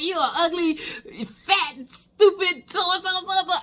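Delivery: high, laughing mock kid's rant. Kid rants at the parent on camera, high and laughing. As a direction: spit out the kid's insults, giggling through them. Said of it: "the kids go on a rant like".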